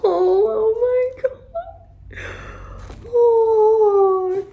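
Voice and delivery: high, slow emotional gush; gush, close to tears; touched gushing. Real, not acted. Opening handmade gift, overwhelmed.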